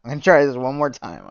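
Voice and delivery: exasperated self-pep shout. Shown as a demonstration. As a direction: psych yourself up and blurt it again.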